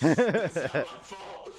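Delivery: laughing. Real, not acted. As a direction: laugh out the words. Repeated through a big laugh.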